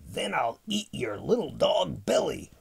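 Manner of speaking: mildly roared monster threat; roaring dinosaur. A narrator's reading. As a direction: lightly growl it like a storybook dinosaur. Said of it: "roared the super hungry dinosaur".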